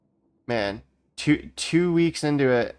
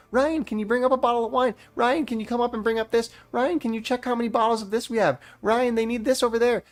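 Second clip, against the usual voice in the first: much higher mocking voice of nagging bosses; mocking managers; 10 semitones higher than usual. Quoting managers on the radio, a high mocking voice. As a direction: mimic them calling nonstop, pitched way up. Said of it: "you guys call me nonstop on the radio".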